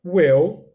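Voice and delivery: Will said loud and clear as "uéu"; loud isolated teacher model of "Will"; pronunciation demo. Shown as a demonstration. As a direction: project "Will" with an open vowel. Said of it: "A pronúncia desse W I L L é well".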